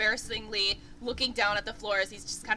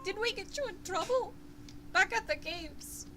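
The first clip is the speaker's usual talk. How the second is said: higher, teary, halting voice; tearful dwarf boy; 10 semitones higher, 4 dB quieter than usual. Acted. Game master voicing the crying dwarf boy apologizing, tearful. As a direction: sniffle through the apology. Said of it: "he sniffs and he's like".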